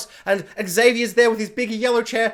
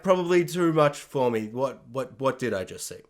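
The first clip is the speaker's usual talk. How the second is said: much lower and softer, stunned; shell-shocked mumble sinking lower; dazed and overwhelmed; 8 semitones lower, 6 dB quieter than usual. Retold or imitated. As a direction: trail off bewildered. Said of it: "other people being like".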